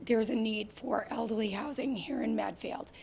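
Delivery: whispering as the voice fails; voice dropping to a whisper. Real, not acted. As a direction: let the voice fade to breath. Voice gives out mid-sentence.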